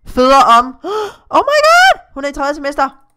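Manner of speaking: loud, high squeal of surprise; gasp, then shriek in amazement; shocked excitement. Real, not acted. Discovers character is about to give birth. (højt, chokeret hvin)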